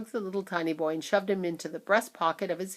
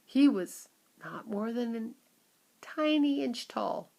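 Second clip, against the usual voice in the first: ordinary delivery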